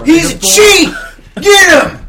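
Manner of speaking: alarm shout. Acted. Game master's bandit shouts to the crossbowmen to attack. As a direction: yell it to rouse the guards. DM's guard raises alarm.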